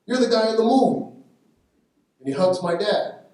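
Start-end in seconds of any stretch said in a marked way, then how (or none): 0.0-1.1 high, loud delighted exclamation; excited recognition. Retold or imitated. De Niro recognizing the father from the film, loud and delighted. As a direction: burst out with happy recognition.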